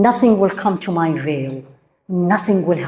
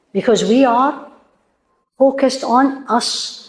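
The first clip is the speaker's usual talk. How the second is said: ordinary delivery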